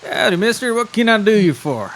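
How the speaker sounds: high, twangy howdy. Acted. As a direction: greet like a tiny country wolf. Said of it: "branching out on your, uh, voices".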